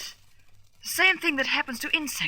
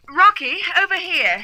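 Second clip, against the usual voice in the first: louder call across the wreck; 6 semitones higher, 8 dB louder than usual. Acted. Di calls Rocky over to her find, calling out. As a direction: call him over, raised.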